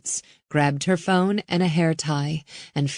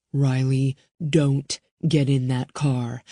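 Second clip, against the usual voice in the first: a lower, firm man's warning; tense man; 4 semitones lower than usual. Acted. Bowman warning Riley, lower and firm. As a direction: forbid it, tense.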